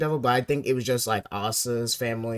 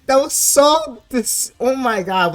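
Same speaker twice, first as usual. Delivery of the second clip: much higher and louder, slow, drawn-out outrage; disgusted outburst; 12 semitones higher, 9 dB louder, slower than usual. Real, not acted. Reacting to the dead cat reveal, loud high disgusted wail. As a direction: wail it like the chapter physically hurt. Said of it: "I'm so sick".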